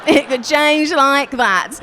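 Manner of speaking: gloating shout. Retold or imitated. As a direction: bellow it slowly, gloating.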